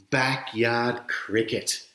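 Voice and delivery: usual speaking voice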